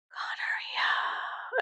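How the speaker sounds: long drawn-out eerie whisper; whispered intrusive thought. Acted. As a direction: whisper it very slowly, like a haunting thought.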